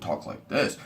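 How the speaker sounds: lightly put-on stage voice; mildly exaggerated, obviously fake delivery. Shown as a demonstration. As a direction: put on a slightly phony voice. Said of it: "I'm being very fake".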